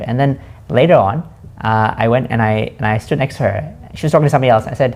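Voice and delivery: ordinary delivery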